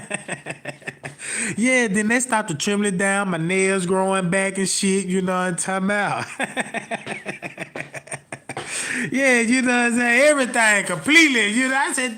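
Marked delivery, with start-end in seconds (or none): 6.3-9.1 soft unvoiced wheezing laugh; breathless laugh. Real, not acted. Laughing at his own punchline, breathless. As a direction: laugh silently at own joke.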